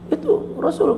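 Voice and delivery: high, alarmed cry of the wife; frightened cry. Acted. (jeritan ketakutan bernada tinggi)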